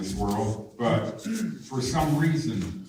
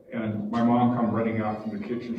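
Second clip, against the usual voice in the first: ordinary talk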